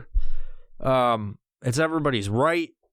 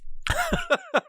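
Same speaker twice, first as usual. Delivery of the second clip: high giggle. Real, not acted. Laugh after a sneeze.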